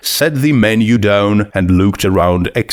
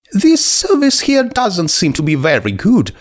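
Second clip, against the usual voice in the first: a much higher, bubbly voice, quickly sorry; high, eager skeleton girl; 11 semitones higher than usual. Acted. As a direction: gush with sudden friendly excitement. Skeleton comments on service.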